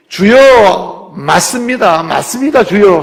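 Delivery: loud, drawn Lord then eager agreement; fervent assent. Acted. Pastor voicing the woman agreeing, loud and fervent.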